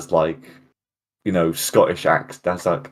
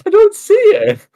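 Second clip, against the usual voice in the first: very high, louder squeal; squeaky denial; 6 dB louder than usual. Real, not acted. Insisting to partner the fix is invisible, squeaky.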